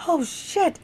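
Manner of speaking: high-pitched startled exclamation; retold shock. Telling friends about the larva in the dream, shocked. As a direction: react to a horrid find.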